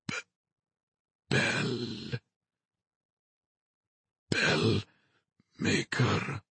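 faint dying whisper, broken; fading whisper. A narrator's reading. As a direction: barely breathe the words out. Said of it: "His voice was faint, a fading whisper".

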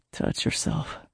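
soft intimate whisper; whispered against lips. Acted. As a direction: breathe it into her lips. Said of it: "he said against my lips".

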